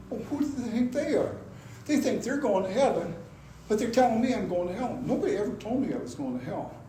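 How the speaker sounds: quick, affronted retort; who are they to judge me; indignant. Retold or imitated. Retelling his offended thoughts.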